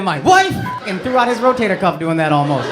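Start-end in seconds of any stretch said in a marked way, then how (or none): 0.0-0.5 loud mock-furious burst; hammed-up resentful bark; angry husband imitation. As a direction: throw it out like an exasperated husband. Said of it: "There's a lot of anger in there".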